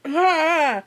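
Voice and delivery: sharp yelp of pain; cry of pain. Acted. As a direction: cry out as if hit. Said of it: "he cries out in pain".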